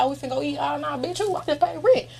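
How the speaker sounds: high, laughing quote of a broke friend; homegirl's voice. Retold or imitated. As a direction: voice the friend turning down food, rising into a laugh.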